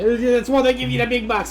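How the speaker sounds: put-on playful Australian accent; Aussie accent. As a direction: lean into the Aussie bit on purpose. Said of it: "I got a little carried away there".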